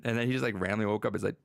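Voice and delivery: normal talking voice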